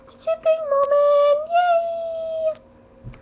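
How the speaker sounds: very high delighted squeal; joyful squeal. Real, not acted. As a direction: squeal like a thrilled fan. Fan thrilled by shipping moment.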